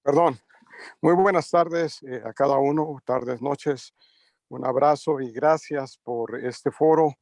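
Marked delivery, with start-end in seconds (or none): none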